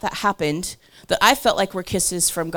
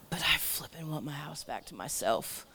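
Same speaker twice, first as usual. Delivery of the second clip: conspiratorial whisper; whispered confession; 5 semitones lower, 12 dB quieter than usual. Real, not acted. Whispering a guilty aside about her cousin.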